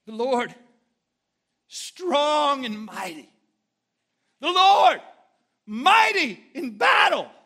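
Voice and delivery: loud, slow, high-pitched Southern shout of the psalm; screaming the psalm. Retold or imitated. Pastor voicing James shouting the psalm in the office, loud. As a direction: scream the words out in slow triumph.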